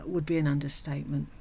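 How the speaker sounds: ordinary talk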